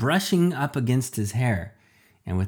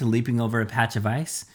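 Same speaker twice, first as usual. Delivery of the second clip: ordinary delivery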